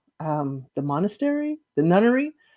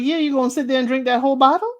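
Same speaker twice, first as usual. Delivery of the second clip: sassy comeback; 6 semitones higher, 3 dB louder, much faster than usual. Retold or imitated. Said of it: "She's like".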